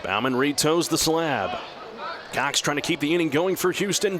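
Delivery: ordinary talk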